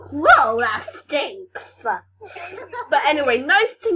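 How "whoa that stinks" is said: very slow loud drawn-out disgust; slow mock disgust. Kid acting a dog's sniff greeting, slow disgust. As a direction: stretch it out as if gagging.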